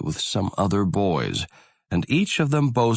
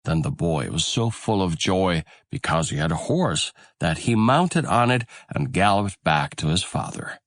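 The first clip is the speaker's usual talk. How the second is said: usual speaking voice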